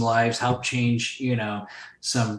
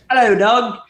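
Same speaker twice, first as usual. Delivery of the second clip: high, loud mock British hello; mock British phone greeting; 11 semitones higher, 10 dB louder than usual. Imitating an English caller answering the phone, high, loud mock British accent. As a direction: answer the phone like a fancy Brit. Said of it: "You answer the phone".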